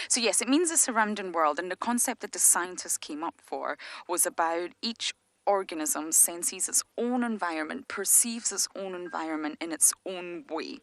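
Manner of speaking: ordinary delivery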